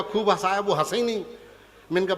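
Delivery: ordinary talk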